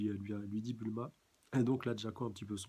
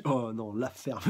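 agacée; 7 dB louder, slower than usual. Acted. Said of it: "elle lui dit".

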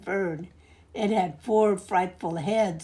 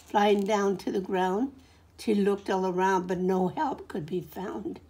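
ordinary delivery

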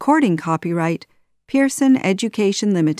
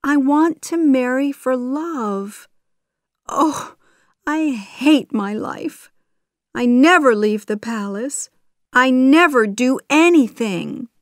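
high, upset princess voice; crying out in protest; 7 semitones higher, 4 dB louder than usual. A narrator's reading. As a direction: complain unhappily, pitched high, building louder. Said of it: "Jasmine cried".

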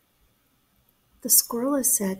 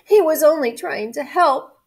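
lightly acted plaintive cry, higher; mildly tearful protest in a raised pitch; weeping cat; 6 semitones higher, 6 dB louder than usual. A narrator's reading. Cat defending the missing duck, mildly weepy. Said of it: "wept the cat".

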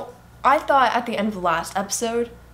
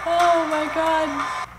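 stunned; 8 semitones higher, much slower than usual. Real, not acted. Shocked by show.

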